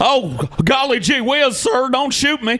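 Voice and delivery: a light gee-whiz nervous voice; pleading politely, mildly nervous; wholesome 1950s kid. Pretend. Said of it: "story where he gets robbed".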